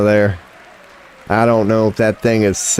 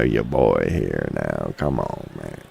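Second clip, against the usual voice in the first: low cool-guy voice; 9 dB quieter, slower than usual. Pretend. Self-styled cool boast.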